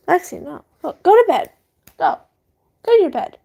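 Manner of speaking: telling the dog Lexi to get off the bed, firm scolding. Real, not acted. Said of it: "Sorry, that was my dog".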